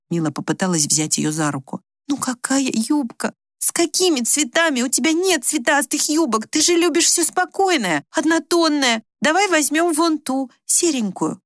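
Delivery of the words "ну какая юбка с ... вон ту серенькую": high-pitched coaxing; pleading persuasion. A narrator's reading. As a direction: reason with her in a high, urgent voice. Daughter argues, trying to talk mother round.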